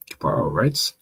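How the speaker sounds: ordinary talk, nothing marked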